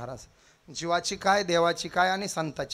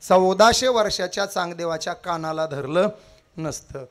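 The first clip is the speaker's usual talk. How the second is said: loud rising kirtan oratory, each line hammered out; booming devotional sermon cadence; preaching at full force; 7 dB louder than usual. Acted. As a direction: preach it to the crowd, building every round.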